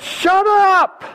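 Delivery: high, sharp silencing yell; hammed-up crowd yelling for silence; acted angry shout. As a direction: shout him down. Crowd silencing the beggar again.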